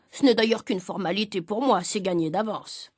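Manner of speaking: low, self-assured aside slowing at the end; smug boasting. A narrator's reading. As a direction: drop the pitch and boast casually. Father boasts of certain victory.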